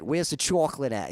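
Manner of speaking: teasing imitation of a New York accent; mocking New York accent. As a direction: exaggerate "chocolate" like a New Yorker. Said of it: "making fun of her accent".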